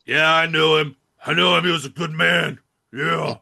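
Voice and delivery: imitating a gravel-free movie hard man, half-hearted; John Bernthal's Punisher voice. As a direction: do a mock tough-guy eulogy, loud on the first lines. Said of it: "it's just his Punisher voice".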